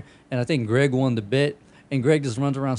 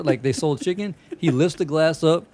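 usual speaking voice

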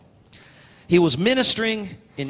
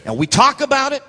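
high emphatic TALK; 7 semitones higher, 3 dB louder than usual. Real, not acted. Preacher chiding believers who only talk, high and emphatic. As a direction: TALK pitched high, voice held up at the end. Same line high, then low.

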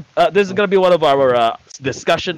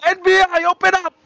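NBI agent; 13 semitones higher than usual. Pretend. Mock NBI raid at door.

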